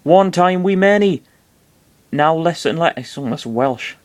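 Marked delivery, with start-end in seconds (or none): 0.0-2.9 high creature voice slipping into West Country; badly attempted West Country critter; bad West Country accent. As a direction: West Country accent veering almost Welsh. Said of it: "doing a West Country accent".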